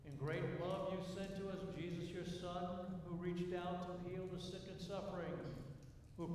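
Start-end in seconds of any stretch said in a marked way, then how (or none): none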